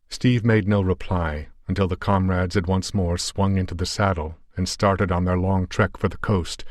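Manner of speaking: ordinary delivery, nothing marked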